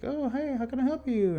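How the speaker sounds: high, sweet receptionist voice; front desk lady. Retold or imitated. Voicing the dispensary lady greeting him, high and sweet. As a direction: put on a chirpy shop greeting.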